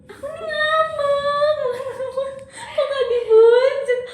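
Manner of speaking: singsong sulking about not being coaxed; sulky whine. Acted. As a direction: whine like a pouting child.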